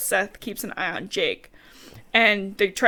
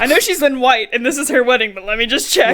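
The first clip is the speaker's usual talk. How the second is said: loud mock self-justifying shout; comic insistence; 4 semitones higher, 10 dB louder than usual. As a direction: belt it out as the joking wolf. Continues the sniffing joke.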